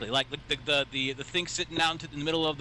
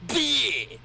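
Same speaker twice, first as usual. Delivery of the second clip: mock trump card; much slower than usual. Friend mocking him playing the blind card, a smug taunting impression. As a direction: sneer it like playing a winning card. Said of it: "pulling out your trump card".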